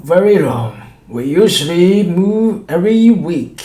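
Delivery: high, loud servile voice; wheedling showman. A narrator's reading. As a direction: explain it with a cringing grin. Shopkeeper explains to the doctor.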